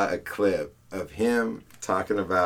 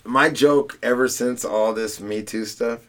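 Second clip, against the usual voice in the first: ordinary talk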